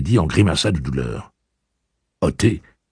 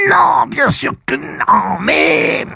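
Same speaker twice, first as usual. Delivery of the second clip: put-on cracking teen voice; 6 dB louder, much slower than usual. Blaine protesting shrilly.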